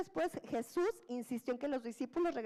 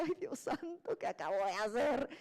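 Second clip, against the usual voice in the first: mock panic; 2 semitones lower than usual. Peter panics as he sinks.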